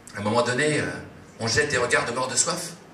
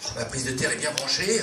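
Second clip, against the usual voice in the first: normal talking voice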